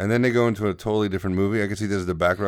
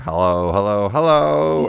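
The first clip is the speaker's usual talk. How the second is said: echoing hello; 6 dB louder, slower than usual. Retold or imitated. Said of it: "I went".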